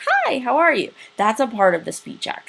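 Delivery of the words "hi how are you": enthusiastic greeting. Shown as a demonstration. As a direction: greet them with a big, high swoop on "Hi". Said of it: "Or did I say, like".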